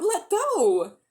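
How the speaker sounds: high, loud cry of let go; shouting. A narrator's reading. Polly shouting at the witch holding her hair, high and loud. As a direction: yell it as your hair is pulled. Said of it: "Polly had been shouting out".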